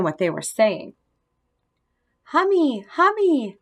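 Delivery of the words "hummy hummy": put-on high toddler call, each word falling; little toddler voice. A narrator's reading. Small brother calls to the visiting hamster in a little high voice. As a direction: call out in a tiny, higher voice. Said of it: "a little voice called out".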